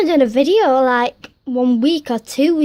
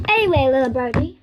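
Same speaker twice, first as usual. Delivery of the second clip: ordinary talk, nothing marked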